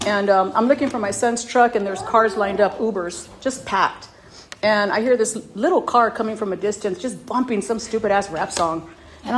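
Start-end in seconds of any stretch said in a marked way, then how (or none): none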